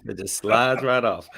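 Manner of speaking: slow, drawn-out playful innuendo; mock sexy. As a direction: linger on the slide, teasingly. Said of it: "That sound kinda sexy".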